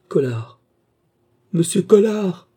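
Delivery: frightened call rising to a high, loud cry; terrified call. A narrator's reading. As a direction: call out for help in terror. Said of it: "appela-t-elle avec un sentiment de terreur profonde". (appel terrifié, de plus en plus aigu)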